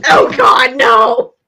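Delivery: loud outburst of dismay; loud, dismayed exclamation; loud protest. Real, not acted. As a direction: cry out in protest. Loud mock horror at the teasing.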